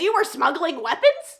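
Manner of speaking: high, loud, incredulous question; shocked accusation. Retold or imitated. Dane's shocked accusation, retold.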